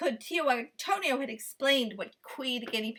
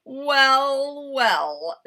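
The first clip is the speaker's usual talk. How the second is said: louder, drawn-out gloating; sly fox; 9 dB louder than usual. A narrator's reading. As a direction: savour the catch.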